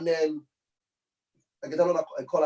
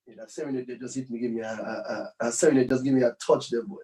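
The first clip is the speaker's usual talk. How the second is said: choked up; 8 semitones lower than usual. Real, not acted. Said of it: "I shiver there".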